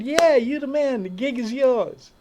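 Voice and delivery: high and loud announcement; excited. Retold or imitated. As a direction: proclaim it with excitement. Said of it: "He said".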